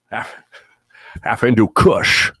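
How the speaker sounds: put-on drawn-out Connery accent on a silly phrase; Sean Connery accent. Host takes a chat dare to say Kush, Sean Connery accent. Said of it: "Sean Connery accent. I double dare you".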